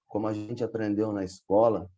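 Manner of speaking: ordinary delivery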